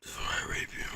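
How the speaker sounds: breathy menacing whisper, no voice; creepy whisper. Pretend. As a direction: hiss it close to the mic. Creepy game voice line whisper.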